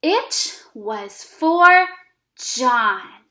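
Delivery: slow, word by word reading; teacher models a sentence for kids to repeat, slowly. Shown as a demonstration. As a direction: read it slowly so they can copy. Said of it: "let's read the sentence over again".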